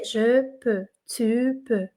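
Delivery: "Je peux, tu peux" softly, as if a text-to-speech voice reads it; like the reader app. Shown as a demonstration. Teacher shows students how the app reads the verbs, soft. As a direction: imitate the app voice reading "Je peux, tu peux" quietly and evenly. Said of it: "ele vai ler pra você".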